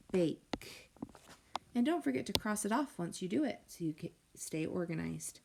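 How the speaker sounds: usual speaking voice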